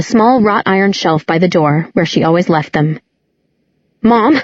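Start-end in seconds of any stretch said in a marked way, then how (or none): 4.0-4.4 calling out. A narrator's reading. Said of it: "Clary called out".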